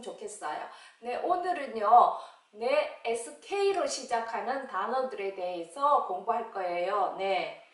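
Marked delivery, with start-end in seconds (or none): none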